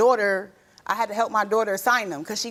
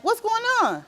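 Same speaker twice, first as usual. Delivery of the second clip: retold alarmed question; 11 semitones higher than usual. Calling dad, retold.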